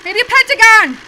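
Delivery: panicked, high, loud yelps; frantic shouting. Real, not acted. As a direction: yell at the pieces in a frenzy. Frantic timed game, panicking at shapes.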